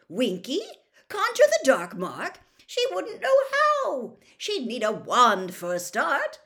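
high, loud and astonished; incredulous. A narrator's reading. Bagman defends Winky from the accusation, incredulous. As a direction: protest in sheer disbelief.

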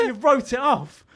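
high-pitched, slow mock outrage; going mad. Boss accuses him of writing off the car, imitated fury. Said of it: "he was going mad".

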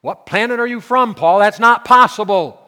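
high, loud, exasperated; loud incredulous protest. Retold or imitated. Preacher echoing the complainer, shouting in disbelief. Preacher repeating the doubter loudly.

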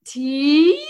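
drawn-out "Tea?" sliding up high and loud; questioning doubt. Retold or imitated. Repeating her reaction to the weak tea, exaggerated questioning. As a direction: stretch "Tea?" into a big baffled question. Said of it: "Question mark".

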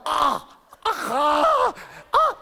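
high yelps of pain, hammed-up; mock pained yelps. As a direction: yelp like being stung. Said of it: "I'm going".

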